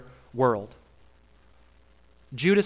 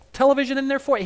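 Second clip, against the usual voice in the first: chirpy mock Jesus; 9 semitones higher, 3 dB louder, faster than usual. Comic paraphrase continues.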